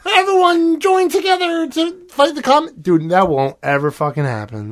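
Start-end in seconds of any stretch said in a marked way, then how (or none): 0.1-2.8 mock cheering crowd. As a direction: gush like a cheesy movie crowd. Mocking a movie's ending crowd.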